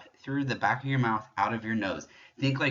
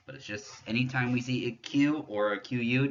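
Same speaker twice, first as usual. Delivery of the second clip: ordinary talk, nothing marked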